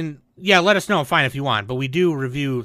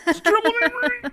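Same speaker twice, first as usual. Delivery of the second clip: hammed-up high squeal of grief, quicker; mock tearful; faster than usual. Joining a co-host's sad quote, mock tearful. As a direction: echo it in a squeaky, fake-weepy voice.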